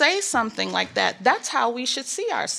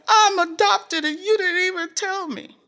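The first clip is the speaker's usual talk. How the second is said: high, crying accusation, retold; tearful accusation; 9 semitones higher than usual. Daughter accusing her mom, high and tearful. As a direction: sob it out as a hurt kid. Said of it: "And I'm crying and I'm bawling".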